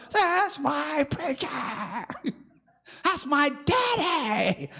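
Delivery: put-on high, slow, childlike doting voice; a child bragging about a violent daddy; mock proud child. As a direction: coo like a proud little kid.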